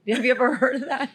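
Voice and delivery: ordinary talk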